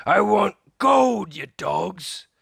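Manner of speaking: loud, slow greedy pirate voice; captain demanding gold from his men. Acted. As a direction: bark orders at the crew.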